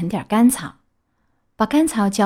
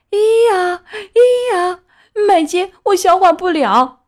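high, loud donkey wail, hee-haw; braying donkey cry; 10 semitones higher, 6 dB louder, slower than usual. A narrator's reading. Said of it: "痛得大哭大叫". (驴子高声哭叫)

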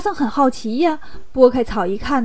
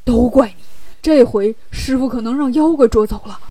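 mildly reproachful grumble; acted complaint with a touch of annoyance; blaming. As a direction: lay the blame on your companion. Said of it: "沙僧就埋怨八戒".

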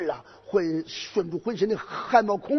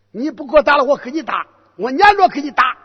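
defiant shout; 5 semitones higher, 5 dB louder, faster than usual. Acted. Boy hero challenging the general.

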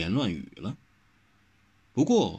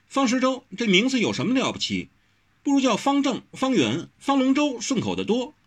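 put-on high-pitched, girlish protest in story narration; insistent young heroine; 12 semitones higher than usual. Wen Rou still insists on questioning the name, insistent high voice. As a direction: voice Wen Rou, indignant and much higher.

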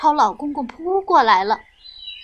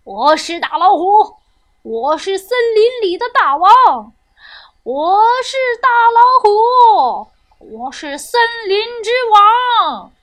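loud, high tiger shouting its power; swaggering tiger's shout, rising as it goes; boastful roaring; 6 semitones higher, 7 dB louder than usual. A narrator's reading. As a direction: bellow it like the self-crowned king of the forest. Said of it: "大呼大叫".

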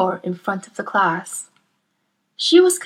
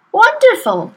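delighted; 12 semitones higher, 8 dB louder than usual. Acted. Teacher delighted by Matilda.

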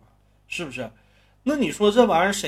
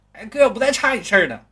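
high, loud cocky little punk promise; mock young punk; 9 semitones higher, 12 dB louder than usual. Storyteller mocking a young punk's hollow promise to his brother. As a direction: toss off a cheap street-kid promise.

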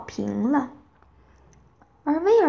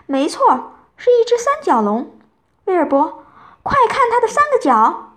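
high, loud burst of discovery; excited cry; 10 semitones higher, 5 dB louder than usual. A narrator's reading. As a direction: call the cat to look, thrilled. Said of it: "他叫起来".

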